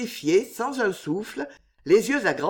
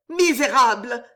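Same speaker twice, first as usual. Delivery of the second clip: loud, higher cry of accusation; desperate outrage; 5 semitones higher, 6 dB louder than usual. A narrator's reading. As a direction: denounce him, spurred by despair. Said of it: "Le désespoir galvanisa la jeune fille".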